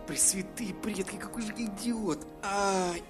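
a facepalm groan, quiet and slow; self-reproach. A narrator's reading. Dragon realising his mistake, softly self-scolding. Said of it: "Ударил себя дракон по лбу".

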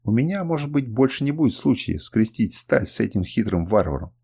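ordinary delivery, nothing marked

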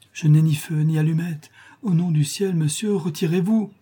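high, urgent begging; desperate plea. A narrator's reading. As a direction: plead with the intruder to leave. Mother pleads with intruder.

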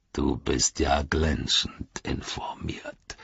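low, slow, soft sneer; hushed, low orc sarcasm; Ugluk, sarcastic. Acted. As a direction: drawl mock admiration quietly.

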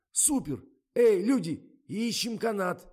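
very high, loud rallying shout; rally everyone with an excited holler; excited calling out. A narrator's reading. Sends the crew hunting for rope, excited shout.

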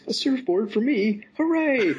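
high, slow gleeful cheer; excited child cheer. Pretend. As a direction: cheer like a delighted kid. Imitating Mike's delight.

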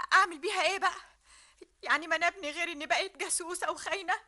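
ordinary talk

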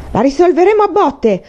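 high-pitched howl, no extra volume; howled threat. A narrator's reading. Enraged boy challenges rival to a fight, howling. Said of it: "ululò quindi".

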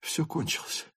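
soft, ashamed inner voice; hushed self-reproach; quiet inner thought. A narrator's reading. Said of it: "подумал Ростов".

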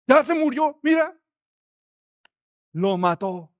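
high, loud accusing cry; accusing crowd. Acted. Onlookers accusing Jesus of killing the boy, high and loud. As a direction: point the finger and blame.

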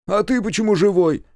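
high, loud challenge; high-pitched, tactless demand; blunt suspicious question. A narrator's reading. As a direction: blurt it out accusingly. Степ blurts suspicious question.

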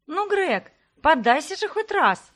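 high, loud playful call; a girl calling out mid-game of tag; a teasing plea shouted across the lawn; ringing shout. Acted. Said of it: "звонко крикнула одна из девушек".

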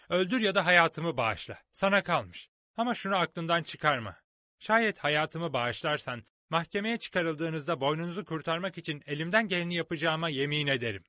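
put-on young hero standing up boldly; bold young Jim. A narrator's reading. Jim defies Silver.

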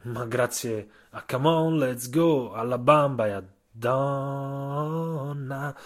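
usual speaking voice

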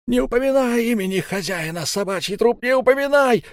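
high, loud distorted screech; distorted shriek. Acted. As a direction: shriek it in a twisted voice. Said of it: "искажённым голосом заверещал Эльм".

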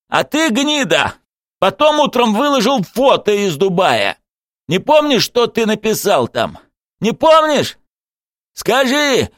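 high, anguished angry yelling; straining shout. A narrator's reading. Coach accuses Zagir through tears, shouting at straining pitch. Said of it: "Тренер кричал, надрывая голосовые связки".

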